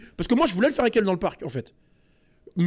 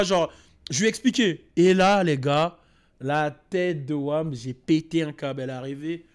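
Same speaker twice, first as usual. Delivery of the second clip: usual speaking voice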